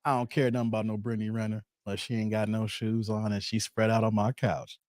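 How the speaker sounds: low, quiet, laid-back mimic of a friend; imitating CJ casually. Host mimicking guest's offhand couch remark, low and quiet. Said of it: "that was so plain the way C J said it".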